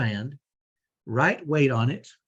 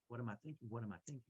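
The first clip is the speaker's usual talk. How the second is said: murmured mantra; 3 semitones lower, 23 dB quieter, much faster than usual. Shown as a demonstration. Repeating mantra under his breath.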